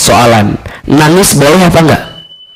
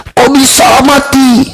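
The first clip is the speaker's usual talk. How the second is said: high, slow mock wail; mock lament; 9 semitones higher, slower than usual. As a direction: drag out the grieving protest. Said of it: "dia meratap tuh". (ratapan pura-pura, tinggi dan pelan)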